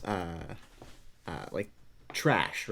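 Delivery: usual speaking voice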